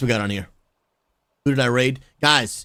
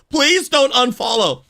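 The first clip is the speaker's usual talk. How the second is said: pleading; 12 semitones higher, 5 dB louder than usual. Real, not acted. Begging viewers after mistake.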